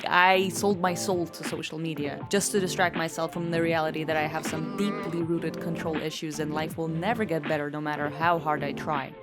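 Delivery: ordinary delivery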